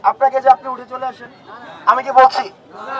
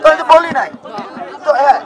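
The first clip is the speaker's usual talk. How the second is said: ordinary delivery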